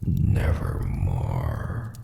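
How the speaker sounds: low, slow, ominous croak; raven voice. Acted. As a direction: drag it out like a dark bird. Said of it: "meant in croaking".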